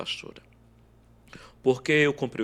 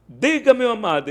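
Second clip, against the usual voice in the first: calling out; 10 semitones higher, 7 dB louder than usual. Retold or imitated. Calling down from the balcony.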